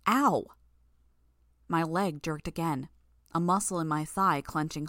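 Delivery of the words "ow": sharp cry of pain as the leg jerks; pained yelp. Acted. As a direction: yelp at a sudden cramp. Sudden leg cramp pain.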